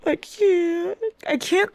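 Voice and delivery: high, drawn-out whimper; overwhelmed whine. Real, not acted. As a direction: squeeze it out high, as if unable to cope. Overwhelmed by the book.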